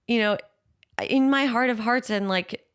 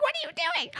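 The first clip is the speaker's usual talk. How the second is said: alarmed therapist; 4 dB quieter than usual. Retold or imitated. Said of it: "my voice therapist will be like".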